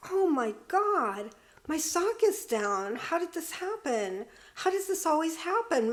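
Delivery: put-on little girl's dismay, climbing in pitch; panicked child thinking. Child's panicked thought about sock.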